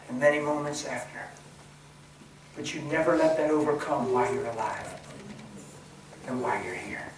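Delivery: normal talking voice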